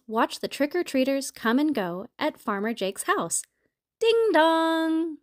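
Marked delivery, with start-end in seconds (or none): 4.0-5.2 sing-song two-note doorbell; doorbell chime. A narrator's reading. Doorbell rings at the farmhouse, sing-song chime. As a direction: chime it high, holding the last note.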